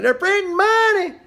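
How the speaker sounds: high-pitched, loud parody of alarmists; mock panic. Analyst mimicking nut-job commentators, mock-panicked shout. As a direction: squawk it in exaggerated mock hysteria.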